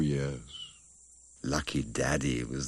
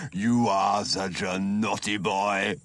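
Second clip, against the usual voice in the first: teasing laugh; 5 semitones higher, 5 dB louder, slower than usual. Acted. Uncle teasing cub.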